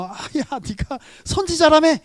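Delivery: loud mocking heckle from below; mocking jeer. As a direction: jeer up at the cross like a scoffer in the crowd. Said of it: "저 같으면 막 밑에서 조롱하잖아요".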